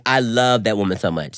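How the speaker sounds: ordinary delivery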